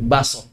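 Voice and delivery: "baso" with no k or catch; k dropped. Shown as a demonstration. Teacher showing majority Indonesian speech, k dropped. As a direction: say "baso" low and smooth, without k. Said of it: "Hadzaf aja".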